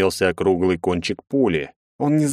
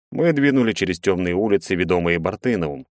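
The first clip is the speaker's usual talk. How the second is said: ordinary delivery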